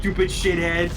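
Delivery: mock harsh Mario line. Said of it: "He drops the voice, everything".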